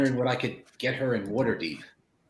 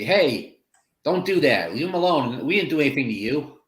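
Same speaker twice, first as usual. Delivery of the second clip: loud, raised-pitch telling-off; protective shout; 6 semitones higher, 5 dB louder than usual. Acted. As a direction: call out to defend your friend. Player defends friend from goblin.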